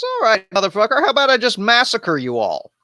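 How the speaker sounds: menacing Jephthah. Retold or imitated. Jephthah answering the Ephraimites, high mock menace. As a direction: toss off the threat with cocky swagger. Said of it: "He says".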